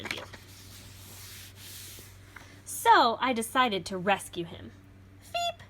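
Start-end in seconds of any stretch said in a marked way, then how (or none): 2.6-4.7 put-on lively read-aloud for children; storybook reading. A narrator's reading. Reads picture book aloud. 5.2-5.6 very high tiny squeak; one sharp critter chirp; creature squeak. Acted. Little beast squeaks in story.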